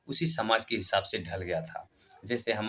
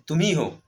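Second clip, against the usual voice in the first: "तुम ही हो" punched higher and louder; emphatic insistence; 6 semitones higher, 5 dB louder than usual. Acted. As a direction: hit "तुम ही हो" with a raised, louder voice. King insists on the reflection.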